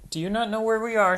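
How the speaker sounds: high, quick worried piglet; Peppa's voice. Acted. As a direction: ask fast, a bit alarmed. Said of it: "said Peppa".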